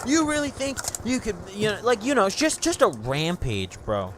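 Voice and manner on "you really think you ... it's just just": high, shrill angry mom. Retold or imitated. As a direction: mimic mom mid-rant. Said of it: "She's like".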